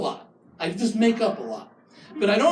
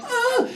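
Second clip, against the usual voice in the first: high sharp pleasure gasp; hammed-up gasp; mock gasp. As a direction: gasp like he hit the spot. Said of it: "She's like".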